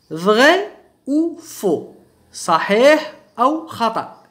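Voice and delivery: very slow, spaced-out French question; slow, word by word. Shown as a demonstration. Teacher announcing a true-or-false exercise to learners, slow and word by word. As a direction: stretch each word and leave gaps between them.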